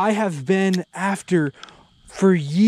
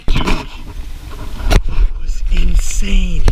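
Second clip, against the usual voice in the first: slow, louder stretched awe; drawn-out exclaim; 9 dB louder, much slower than usual. Real, not acted. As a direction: stretch the word out in amazement.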